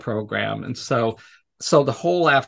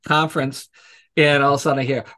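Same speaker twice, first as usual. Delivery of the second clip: normal talking voice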